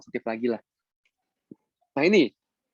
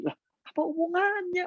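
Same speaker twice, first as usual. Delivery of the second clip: much higher, baffled what-does-that-have-to-do-with-it; incredulous; 13 semitones higher, 3 dB quieter than usual. Acted. Coach baffled by a member's excuse about her child, much higher. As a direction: scoff in disbelief.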